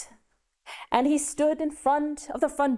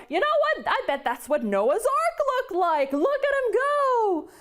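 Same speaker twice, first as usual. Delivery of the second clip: amazed onlooker; 10 semitones higher than usual. Acted. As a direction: call out like a dazzled person in the crowd. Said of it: "people right now who are looking up going".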